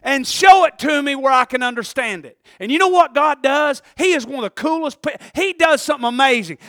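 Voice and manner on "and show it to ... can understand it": loud, emphatic plea to God; punchy, raised-voice prayer; bold prayer. Acted. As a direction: call out to God with drawn-out insistence. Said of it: "you gotta ask God".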